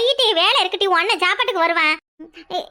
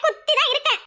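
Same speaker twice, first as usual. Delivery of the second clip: higher, teasing echo of குத்தி தான் இருக்கேன்; mocking repeat; 6 semitones higher than usual.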